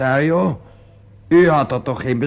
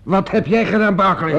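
higher, louder shouted demand; furious accusation; 5 semitones higher, 6 dB louder than usual. Acted. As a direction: hurl the question at him. Accuses Barclay of murder. (woedend geschreeuwde beschuldiging)

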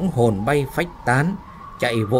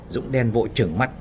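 ordinary delivery, nothing marked

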